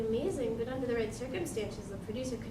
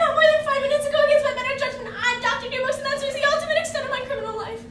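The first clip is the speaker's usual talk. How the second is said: high, loud, frantic protest; agitated prim objection; panicked refusal; 13 semitones higher, 10 dB louder than usual. Acted. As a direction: shrilly insist on getting out of it.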